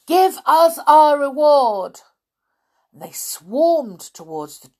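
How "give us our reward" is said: high, loud, insistent yell; crowd's demanding shout. A narrator's reading. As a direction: yell it like an angry mob. Angry crowd yells demand at children.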